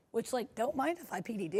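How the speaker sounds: soft, sly villain voice. Acted. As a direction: purr it like a scheming villain. Villain wordplay, low far voice.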